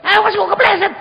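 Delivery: very high, very loud scolding yell; angry shout. Shown as a demonstration. Child snapping at an old mother about slipping, angry shout. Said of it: "Itu parah kalau saya".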